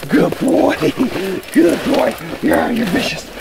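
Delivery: high sing-song praise; high cooing praise for the dog; dog praise baby talk. Acted. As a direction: gush over the dog in a high voice.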